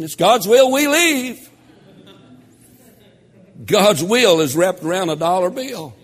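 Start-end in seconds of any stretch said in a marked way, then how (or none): 0.0-1.5 mock churchgoer. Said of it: "First time we have a stewardship meeting".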